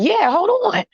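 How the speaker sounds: high-pitched, put-on agreeable reply; acted eager reply. Man asked for his business card, acted eager and high.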